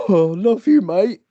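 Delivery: high, loud mock drunk affection; happy drunk. Merry drunk telling everyone, gushing loudly. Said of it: "the happiest person on earth".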